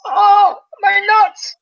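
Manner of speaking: high, loud pained squirrel cry. Retold or imitated. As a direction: yelp it like a trapped squirrel. Squirrel voiced in pain.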